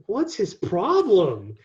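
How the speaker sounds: mock affronted disbelief; irked reaction. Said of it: "when I read it, I was like".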